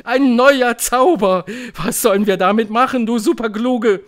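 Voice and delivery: high, loud cheering witches; hooting crowd. A narrator's reading. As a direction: whoop it out in admiration. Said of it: "johlten sie".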